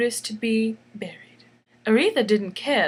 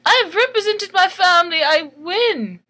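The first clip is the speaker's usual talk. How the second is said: higher, louder proud boast; triumphant; 7 semitones higher, 6 dB louder than usual. Acted. Aretha boasting to the ruler, triumphant. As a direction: crow it like a winner. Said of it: "Aretha saying".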